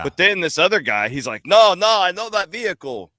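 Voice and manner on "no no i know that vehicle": high-pitched, badly faked Irish accent; botched Irish accent. Rental guy backing up the customer, in a botched Irish accent. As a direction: put on a muddled foreign accent, pitched up. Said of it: "I don't know what accent you're doing".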